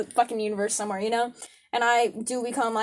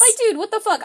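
high, loud jokey indignation; playfully offended rant at a shrimp; mock outrage; 11 semitones higher, 5 dB louder than usual. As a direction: scold the shrimp in fake fury.

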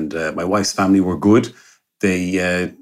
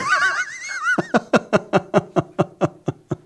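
high laugh. Real, not acted. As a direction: burst of laughter. Laughing at joke about wife.

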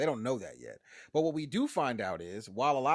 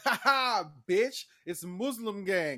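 higher, louder mocking laugh; taunting Sharif; 6 semitones higher, 5 dB louder, slower than usual. Sharif taunts them, higher and slow.

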